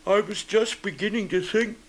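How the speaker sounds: high, slightly sorry bear voice; mildly woeful storybook bear; sniffly bear. A narrator's reading. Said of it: "sniffing slightly".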